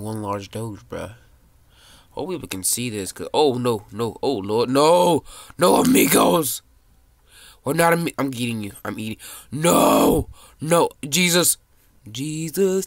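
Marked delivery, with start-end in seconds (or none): 4.7-8.1 loud, high, stretched-out no; panicked yell. Real, not acted. Kid gaming, yelling loudly at players closing in on him. 9.5-12.8 loud cries as he's eaten; scream. Real, not acted. Kid gaming, screaming as another player eats him.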